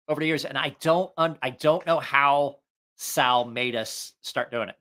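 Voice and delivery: normal talking voice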